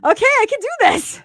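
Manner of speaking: high, loud burst of resolve; mock triumphant. Client finished crying on the call, mock triumphant. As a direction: pipe it up with hammed-up confidence. Retold relief after crying.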